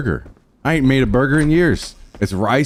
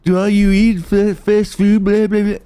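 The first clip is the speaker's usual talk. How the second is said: mocking whiny voice; 8 semitones higher than usual. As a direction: sneer it like a know-it-all. Mocking viewers about fast food.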